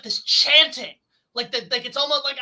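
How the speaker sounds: usual speaking voice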